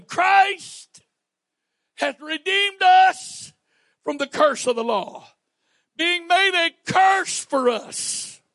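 loud, high, ringing scripture proclamation; full-force preaching. Acted. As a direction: proclaim the law and the curse to the church.

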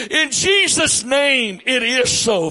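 loud, high fervent prayer; preaching prayer. Real, not acted. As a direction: cry out in prayer. Preacher praying at full force.

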